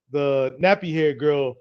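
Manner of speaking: ordinary talk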